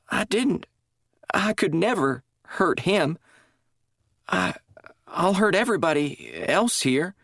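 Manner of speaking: high, halting and broken; plead, unravelling; confused denial. A narrator's reading. Confused drugged woman insisting to chaplain, broken pleading.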